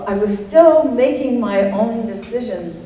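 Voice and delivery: normal talking voice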